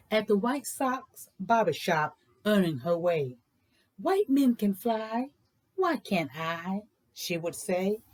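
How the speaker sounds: lilting, sing-song read-aloud voice; bouncy rhythmic picture-book reading; storybook narration. A narrator's reading. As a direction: read it like a rhyme to children. Reads a rhyming picture book.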